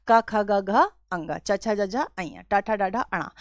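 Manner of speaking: का ख ग घ अंगा recited with the वर्ग consonants as का, चा, टा; regional recitation. Shown as a demonstration. Teacher to students, showing Bareilly-style recitation of consonants. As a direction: chant the rows the way Bareilly children learn them. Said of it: "सिखाया जाता है बचपन से उच्चारण में".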